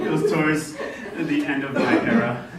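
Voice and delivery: chuckling through the line; laughing. Real, not acted. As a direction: still laughing while talking. Laughing at his own age.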